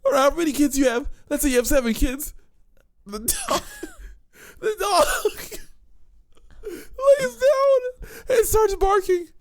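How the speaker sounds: high-pitched, tearful laughter; can barely talk for laughing; laughing to tears. Real, not acted. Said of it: "You're covered in tears".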